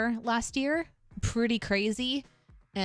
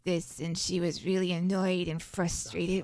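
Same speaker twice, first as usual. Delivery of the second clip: Bab's low voice. Shown as a demonstration. Streamer showing Bab's original low voice, annoyed character. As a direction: speak as the annoyed old Bab. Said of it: "she was down here like this".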